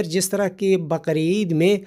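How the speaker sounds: normal talking voice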